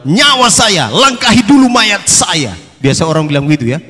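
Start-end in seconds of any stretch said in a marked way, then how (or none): 0.1-2.5 louder, higher, chest out like a brash hero; a swaggering vow, voice raised high; bold boast. Acted. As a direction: declare it like you'd die first. Peter boasts bravely to Jesus.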